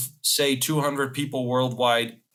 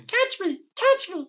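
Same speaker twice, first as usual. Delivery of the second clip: very high squeaky cartoon voice; South Park impression; slower than usual. Quoting South Park in a high squeaky voice. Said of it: "like that South Park, you know, chasing the dragon".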